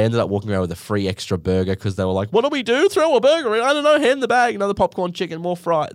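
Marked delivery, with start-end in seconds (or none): 2.3-5.9 high, panicked fast-food staff; flustered staff. Retold or imitated. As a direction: squeak it out like confused kids behind the counter. Staff panicking over lost order.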